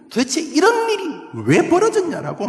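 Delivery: very high, loud outcry; desperate cry. Acted. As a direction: shout the why to heaven. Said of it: "절규".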